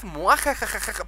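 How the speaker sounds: hammy villain laugh; evil cackle. Acted. Villain laugh after boast.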